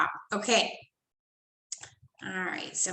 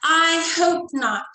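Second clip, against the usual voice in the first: higher, slow, louder protest; shouted; 6 semitones higher, 6 dB louder, slower than usual. Acted. Girl refusing the new family, shouting. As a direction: push back hard. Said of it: "shouted Lucy".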